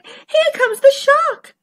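high-pitched cry of alarm; panicked fish. Acted. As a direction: sound the alarm as a little fish. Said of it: "The flounders were floundering".

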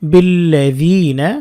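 বিল্লাযিনা drawn out, the লা held one alif; slow recited word. Shown as a demonstration. Teacher recites বিল্লাযিনা slowly for students. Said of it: "এক আলিফ টেনে পড়তে হয়".

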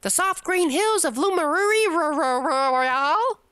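high put-on voice; character voice. Villager thanks Aurora, high grand put-on voice. Reading game dialogue in character.

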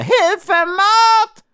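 very high, loud panicked yell; shrill shout of fear; screaming. Acted. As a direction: howl for help in terror. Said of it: "schrie er".

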